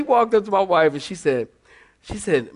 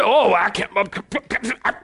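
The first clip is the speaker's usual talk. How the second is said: mock scandalized churchgoer, sputtering and broken up; stuttering, exaggerated churchy indignation; religious folk. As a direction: parody a pious gossip tripping over the words. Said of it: "that's how they talk".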